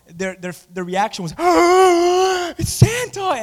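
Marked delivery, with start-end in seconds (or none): 1.4-2.5 sharp high gasp of delight; excited gasp. Retold or imitated. Kids see Santa in hall. 2.6-3.4 put-on high, thrilled child's cry; excited child. Kids see Santa in hall.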